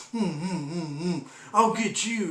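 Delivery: slow, sulky pouting growl; grumpy dog voice. Pretend. Voicing his pouting dog's revenge threat, slow and sulky. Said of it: "She's like".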